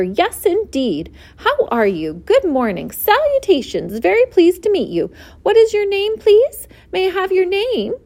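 excited pig, voice climbing high; eager greeting. A narrator's reading. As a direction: pile up greetings, rising with eagerness. Delighted pig greets new friend.

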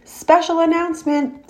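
grand announcer voice; pompous. A narrator's reading. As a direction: announce it pompously, high and proud. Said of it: "said the loudspeaker in a pompous voice".

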